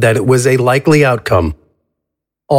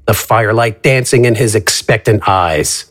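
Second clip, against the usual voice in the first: ordinary delivery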